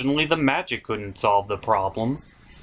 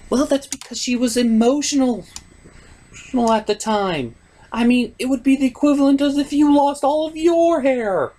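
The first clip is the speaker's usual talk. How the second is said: much higher put-on lady voice, arguing back; girly falsetto voice; 11 semitones higher, 4 dB louder than usual. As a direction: argue with him in a high falsetto lady voice. Falsetto second voice explains.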